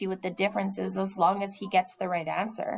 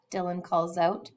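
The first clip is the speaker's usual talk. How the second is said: ordinary delivery, nothing marked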